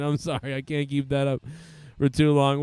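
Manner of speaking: usual speaking voice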